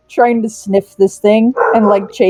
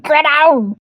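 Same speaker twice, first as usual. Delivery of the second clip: higher, swooping pet voice calling up; pet's playful demand; 6 semitones higher, much slower than usual. Acted. Jumping pet calls to the hovering creature, playful demand.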